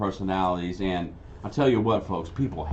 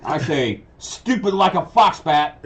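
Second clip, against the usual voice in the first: hammy supervillain, high and loud; Foxbat; 7 semitones higher, 5 dB louder than usual. Acted. Silly supervillain boasting to his audience, hammed-up proclamation. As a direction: proclaim it grandly, building to a loud punchline. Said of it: "it's all in how you play him".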